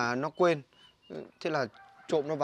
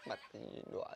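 lẩm bẩm; 9 semitones lower, 19 dB quieter than usual. Acted. Anh lầm bầm chê em.

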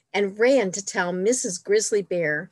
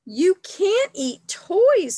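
put-on higher, louder, slow indignant child voice; glaring reproach; 10 semitones higher, 5 dB louder, slower than usual. Fred scolding Mister Bear about the basket of toys, slow high indignant. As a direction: drag each word out, scolding. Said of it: "glaring at Mister Bear".

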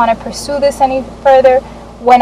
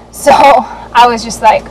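ordinary talk

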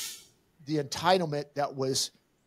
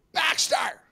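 high shrieking call of the dog's name; mock yell. Dog owner yelling for her dog mid-backswing, high mock shriek. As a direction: shriek the name like she's losing it. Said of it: "she's like".

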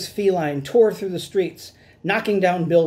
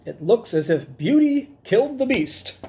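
higher character voice, sounding solemn; police chief voice; 6 semitones higher than usual. Acted. Chief of police sums up Cat Kong's fall, solemn. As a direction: deliver the verdict like a proud chief. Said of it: "said the chief of police".

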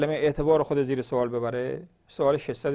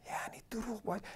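whispered aside; 4 semitones higher, 15 dB quieter than usual. Real, not acted. Aside to himself.

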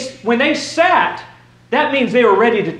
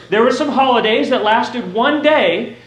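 ordinary talk, nothing marked

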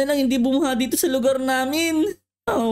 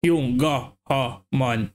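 deep elder voice; 12 semitones lower than usual. Acted. Villager answering a question about a disease, in a low grave voice. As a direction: drop way down and speak like a solemn elder.

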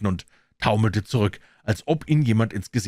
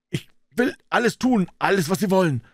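high-pitched, frantic pleading; panicked offer, voice far up; desperate cry; 11 semitones higher than usual. A narrator's reading. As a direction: cry out as if begging for your life. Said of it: "schrie Jennings".

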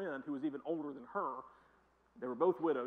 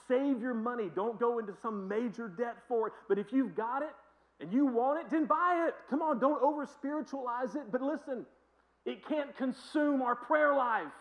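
preaching at full force; 7 semitones higher, 7 dB louder than usual. Real, not acted. Preaching climax.

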